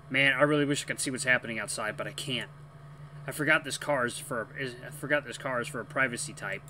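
usual speaking voice

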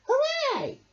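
very high, joyful cheer. Acted. The friends cheer the fair share, high and joyful. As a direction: cheer with everyone. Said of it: "everyone cheered".

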